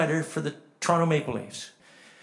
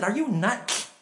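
high incredulous retort; indignant disbelief; 7 semitones higher, slower than usual. Retold or imitated. Dying Cecil to his pitying friend, indignant disbelief. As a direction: scoff at the pity, pitched up.

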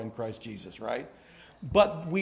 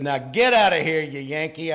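playful louder Southern send-off; loud Southern jab; 2 semitones higher, 7 dB louder than usual. Retold or imitated. Arkansas clerk chasing off the Yankee, loud Southern jab. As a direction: shoo the Yankee off, Southern style.

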